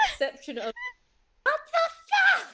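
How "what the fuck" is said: high yelled disbelief; shocked shout. Real, not acted. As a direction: blurt it out in shock. Shocked at roll result.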